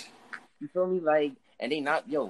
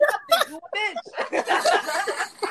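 high, louder, drawn-out laughing retort; 5 dB louder, much slower than usual. Retold or imitated. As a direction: crack up hard while quoting the comeback. Cracking up while retelling a comeback.

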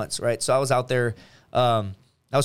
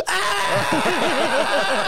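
high, held "ah" scream; mock group scream. Swedish friends reacting to seeing her pass by, mock scream. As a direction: imitate friends screaming in uproar. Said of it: "you just hear this loud scream".

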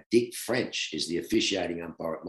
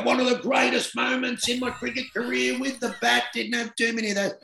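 much higher, pompous self-praise voice; mock grandiose. Retired player jokingly boasting to the audience in a high pompous voice. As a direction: puff it up like a grand boast, then undercut it.

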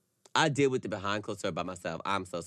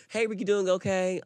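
mock sweet greeting; 11 semitones higher than usual. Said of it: "if I stop talking to you".

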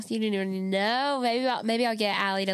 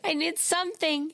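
pleading; 7 semitones higher than usual. Retold or imitated. Begging mom to go out.

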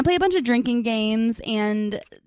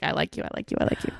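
much lower and softer, repeating it shyly; mock shy confession; 8 semitones lower, 8 dB quieter, faster than usual. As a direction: mumble it like an awkward confession. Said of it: "sober conversation of, like".

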